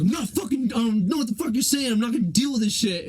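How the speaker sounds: hammed-up pissed-off storm-out; imitating an angry friend. Friend mimicking Mikey storming out of class, mock furious. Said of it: "he's like, he's like".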